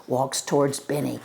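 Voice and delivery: plain reading voice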